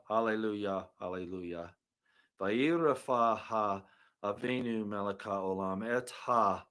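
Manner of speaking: soft murmured Hebrew blessing; hushed fading prayer; quiet prayer. Real, not acted. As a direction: pray low and reverent, fading out.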